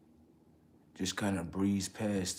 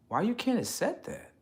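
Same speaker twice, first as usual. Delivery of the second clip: much higher, rising disbelief; incredulous question; 13 semitones higher than usual. Real, not acted. Questioning people who won't accept him, high incredulous. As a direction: demand it, baffled.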